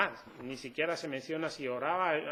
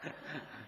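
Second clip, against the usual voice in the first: breathy laugh; 12 dB quieter than usual. Real, not acted. Laughs at own act.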